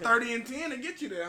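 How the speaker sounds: high, loud hype; hyped up. Real, not acted. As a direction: pump it up like a fan. Hyped rhetorical question.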